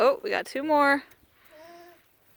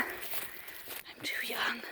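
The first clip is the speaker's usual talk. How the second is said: put-on slow, breathy whisper of I'm too young; slow hushed whispered echo; 3 semitones lower, 16 dB quieter, much slower than usual. Shown as a demonstration. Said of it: "repeated as a whisper".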